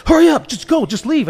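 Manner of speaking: high, pressing hurry-up voice; high mock panic, shooing him off; urgent retold warning. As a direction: hustle him out, imitating the frightened director. Director urgently sends him away.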